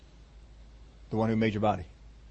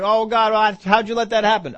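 higher, louder imitated complaint to God; mock complaining; 7 semitones higher, 6 dB louder than usual. Preacher imitating bereaved folks blaming God, loudly complaining. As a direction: cry out in mock grievance. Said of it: "they're out there complaining".

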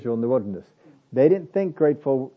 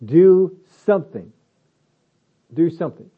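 emphatic command; 3 semitones higher, 6 dB louder than usual. Shown as a demonstration. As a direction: hit it hard, push them to act. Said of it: "Not asking you to do".

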